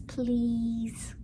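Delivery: ordinary delivery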